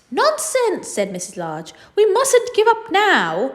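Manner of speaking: mum refusing to give up; bossy mum. Acted. Mum insists firmly.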